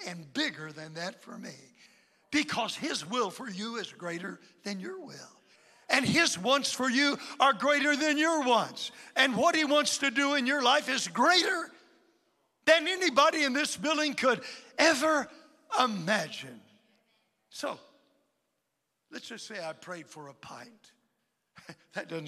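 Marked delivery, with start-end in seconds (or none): 5.9-16.6 louder and higher, ringing pulpit build; rising pulpit crescendo; preaching climax. Acted. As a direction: drive it home to the whole congregation.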